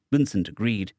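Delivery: plain reading voice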